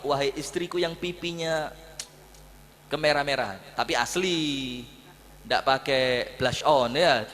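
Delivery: woo the wife back gently; tender coaxing. Acted. The Prophet sweet-talks his wife. (merayu istri dengan lembut dan pelan)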